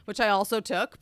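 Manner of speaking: usual speaking voice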